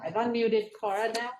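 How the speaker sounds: usual speaking voice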